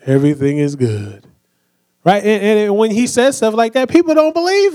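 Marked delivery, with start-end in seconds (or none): 0.0-1.3 low, slow, droopy donkey voice; mopey cartoon donkey impression; Eeyore voice. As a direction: say happy words in Eeyore's dead gloom. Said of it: "Eeyore, he's always just like".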